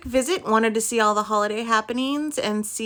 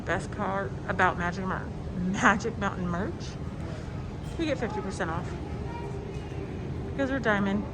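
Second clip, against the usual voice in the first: muffled voice; 7 dB quieter than usual. Real, not acted. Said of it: "sorry I sound muffled. Mask's inside".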